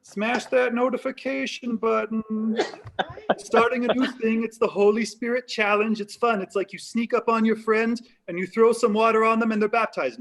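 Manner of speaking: high-pitched, hyped YouTuber voice; Jesus. Acted. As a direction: pitch a viral challenge to your followers. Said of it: "try to get famous on YouTube".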